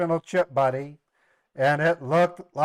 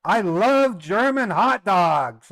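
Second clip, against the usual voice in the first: slow, mock-proud declaration, a bit louder; teasing line for the camera; 6 semitones higher, 5 dB louder, slower than usual. Real, not acted. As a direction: tease the German family on tape. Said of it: "and I said on the camcorder".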